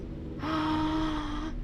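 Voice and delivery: long, high gasp; horrified gasp. Acted. Reader as girl who can't see her arms, horrified gasp. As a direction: draw breath in as if horrified.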